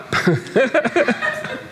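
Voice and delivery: high amused laugh. Real, not acted. Preacher laughing at being seen dancing. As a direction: laugh at the picture of it.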